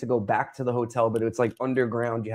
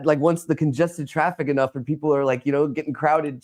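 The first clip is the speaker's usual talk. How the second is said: ordinary talk, nothing marked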